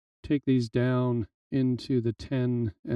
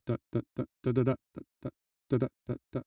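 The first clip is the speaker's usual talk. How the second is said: soft rhythmic da syllables mimicking a shaker; vocal shaker imitation; 5 dB quieter, much faster than usual. Shown as a demonstration. Teacher showing students the shaker rhythm, softly vocalised da beats. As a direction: tap out the shaker pattern with your voice, quietly. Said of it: "you hear it going".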